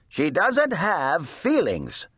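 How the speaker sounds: mild, high narrated shout. A narrator's reading. Eugenia argues with her sister, mild indignant shout. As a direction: snap back with a high, drawn-out touch of shouting. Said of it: "shouted Eugenia".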